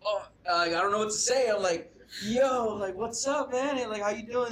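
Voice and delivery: high-pitched, excited greeting; starstruck fluster. Retold or imitated. Fan greets his idol on FaceTime, flustered and high. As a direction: re-enact being tongue-tied and thrilled.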